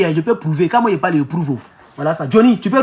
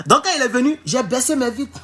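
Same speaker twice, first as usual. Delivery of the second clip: ordinary talk, nothing marked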